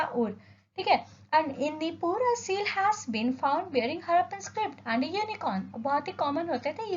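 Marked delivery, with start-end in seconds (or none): 1.3-5.6 slow, deliberate dictation of slide text; measured classroom read-aloud. Acted. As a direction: read each word out slowly for notes. Reads slide text slowly.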